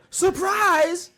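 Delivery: high-pitched mocking "surprise!"; drawn-out high mock shout; mock yell. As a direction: mimic an annoying announcer's yell. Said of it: "You gonna yell, "Surprise"?".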